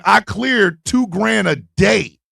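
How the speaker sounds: slow, even, self-assured statement; matter-of-fact boast. Retold or imitated. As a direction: state it slowly and coolly. Woman states her earnings.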